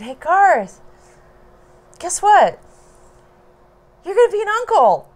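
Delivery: high, loud, bursting with news; excited announcement. Retold or imitated. Telling her brother the baby news, loud and high with excitement. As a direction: blurt out the big news with glee.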